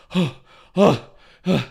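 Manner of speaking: heavy gasps imitating a winded runner; out of breath. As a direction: pant hard, like he just ran across. Acting out winded man at railing.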